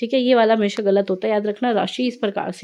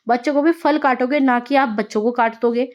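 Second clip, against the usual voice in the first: ordinary delivery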